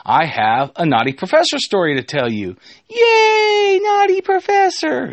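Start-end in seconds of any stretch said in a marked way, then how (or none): 2.9-5.1 squealing high, loud mock cheer. Imagined listeners greet the story, mock high-pitched cheer. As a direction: cheer in a silly, very high put-on voice.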